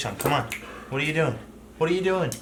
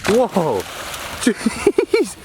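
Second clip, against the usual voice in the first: ordinary talk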